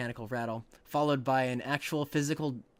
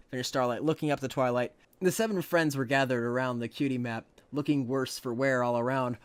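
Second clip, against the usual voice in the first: ordinary delivery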